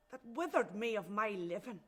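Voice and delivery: rising, impassioned bitterness; bitter lament. Acted. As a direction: accuse with mounting, wounded force. Building lament against her religion.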